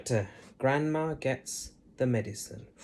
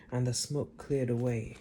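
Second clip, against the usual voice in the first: ordinary delivery, nothing marked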